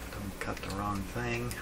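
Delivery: normal talking voice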